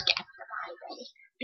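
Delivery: slow, creepy whisper; a slow menacing whisper. Retold or imitated. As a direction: breathe it out slowly like a threat. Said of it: "he goes".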